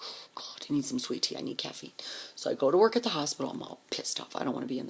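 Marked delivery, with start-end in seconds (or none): 0.0-1.9 soft desperate whisper; whispered craving; whispered longing. Retold or imitated. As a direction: breathe it like you're parched. Craving sweet tea, exhausted.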